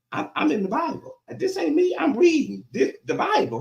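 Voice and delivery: high-pitched disclaimer; don't blame me, said way up high; mock-defensive protest. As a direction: plead innocence in a high voice. Preacher disclaims responsibility for the words.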